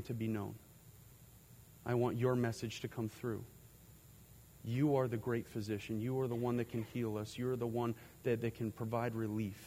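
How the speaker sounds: soft, low, hushed prayer with long pauses. Real, not acted. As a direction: pray quietly and earnestly, leaving space between requests. Leads the congregation in prayer.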